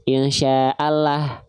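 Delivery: "Allah" in "Insya Allah" slow with a heavy lam; thick lam in insya Allah. Shown as a demonstration. Teacher demonstrating heavy lam for students, slow and thick. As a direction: stretch "insya Allah" slowly with a full, dark lam. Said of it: "dibaca tebal".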